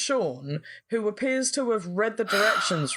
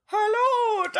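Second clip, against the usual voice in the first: hammed-up child's Scottish accent, pitched way up; mock Scottish accent; 13 semitones higher than usual. Imitating the film's leprechaun boy, mock Scottish accent. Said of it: "Scottish accent".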